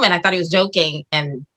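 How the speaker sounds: normal talking voice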